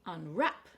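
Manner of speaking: "Unwrap" with light Un, rising strong wrap; second-syllable stress. Shown as a demonstration. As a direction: hit WRAP in "Unwrap", ending on a rise. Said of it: "stress is on the second syllable".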